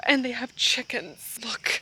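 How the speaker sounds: hushed whisper about the chickens; hushed whisper pointing out the chickens. Real, not acted. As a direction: whisper so the chickens stay calm. Quiet near chickens.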